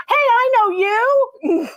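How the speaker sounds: drawn-out, high and loud, delighted to recognise her; excited greeting. Real, not acted. Host happily recognizes a returning viewer on the live show, excited.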